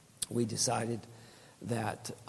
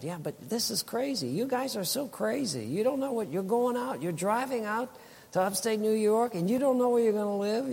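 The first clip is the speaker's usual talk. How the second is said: imitating a sister-in-law, much higher and disbelieving; higher-pitched mocking scold, playing up her disbelief; mock incredulous sister-in-law; 10 semitones higher than usual. Sister-in-law scolding the couple, mock incredulous high voice.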